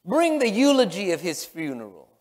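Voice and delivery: usual speaking voice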